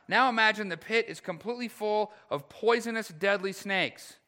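high, loud pulpit storytelling; urgent, emphatic sermon illustration; impassioned preaching. Real, not acted. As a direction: paint the peril with full preaching force. Preaches a vivid pit illustration.

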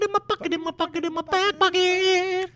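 silly high falsetto teasing chant; high falsetto sing-song. Pretend. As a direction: squeal it way up high, slowing at the end. Riffing on back pocket, sing-song.

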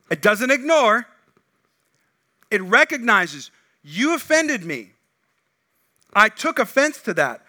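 loud, slow, emphatic preaching; preaching at full force. Acted. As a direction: drive the point home from the pulpit. Preaching climax.

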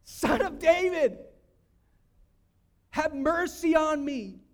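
loud, high, drawn-out cry; yelled out. Retold or imitated. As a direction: yell it slowly as a desperate plea. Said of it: "He yelled out".

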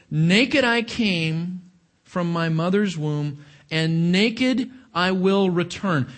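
slow, weighty "Naked" at each start; stress on naked. Shown as a demonstration. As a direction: press down on "Naked" and "naked". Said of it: "the word naked is emphatic".

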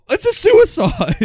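high, drawn-out "suicide"; mimicked suicide. Co-host joining in mimicking the character, drawn-out suicide. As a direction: stretch "suicide" slowly, pitched well up. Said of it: "kind of like a lispy".

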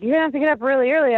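quick rushed warning; fast warning. Retold or imitated. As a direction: rattle it off fast.

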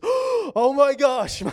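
high, slow, breathless "oh my gosh"; stunned girl, drawn-out gasp of wonder; astonished gasp. Retold or imitated. Preacher voicing amazed girl at camp, high astonishment. Girl gasps as her eye shuts.

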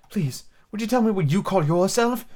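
high, timid little plea. A narrator's reading. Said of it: "she said timidly".

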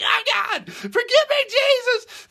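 very high, very loud hammed-up wailing plea; mock wailing cry. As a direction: overplay a sobbing sinner at the altar. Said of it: "screaming and crying".

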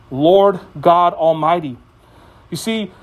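usual speaking voice

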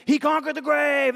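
excited high shouting plotters; disciples plotting. Retold or imitated. Pastor voicing disciples in a comedy video, excited shout. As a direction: shout it like giddy schemers around a bonfire. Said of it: "they were like".

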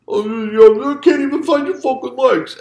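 high, loud punchy mumble; punchy Stallone. Retold or imitated. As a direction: do a concussed Rocky cracking a joke. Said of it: "sounded like Rocky telling a joke".

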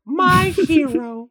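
high, slow swooning sigh with a laugh. Pretend. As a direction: swoon over him, laughing. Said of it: "swooning over Ryan Gosling".

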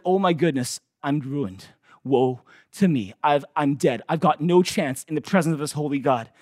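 the prophet whispering his doom in awe; hushed dread. Acted. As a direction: breathe it out in quiet horror. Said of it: "and he's like".

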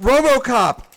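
loud, high movie-title announcement; excited announcement. Acted. Host unveiling a gift, loud and high.

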